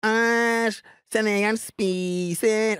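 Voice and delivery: high, slow mocking yell; retold childish scream. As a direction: jeer at a classmate across the room. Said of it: "skriker jeg". (hånende barneskrik, høyt og langsomt)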